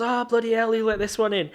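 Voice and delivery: high, loud mock-outraged teasing; playfully scandalised, ribbing an old friend. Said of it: "and he goes".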